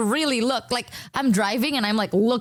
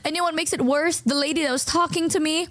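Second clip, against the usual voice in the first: ordinary talk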